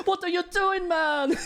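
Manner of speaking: put-on high, laughing stab at a German accent; squeaky-high mock German with laughter; bad German accent. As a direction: badly fake a German, cracking up. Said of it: "how to do a German accent".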